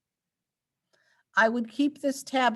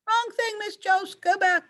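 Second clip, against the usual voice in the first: much higher, mock scolding herself; self-scolding; 12 semitones higher than usual. Real, not acted. Teacher scolds herself for the wrong screen in a high mock voice. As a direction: squeak a telling-off at yourself. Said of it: "scolding herself in a high voice".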